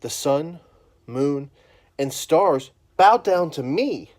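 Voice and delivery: loud, slow, grand proclamation; proud boast. Acted. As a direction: announce it like a king. Joseph boasting his dream.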